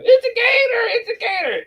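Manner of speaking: high-pitched panicked crowd yell; mock scream. Bar owner's everybody screaming about a loose gator, mock scream. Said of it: "everybody's screaming".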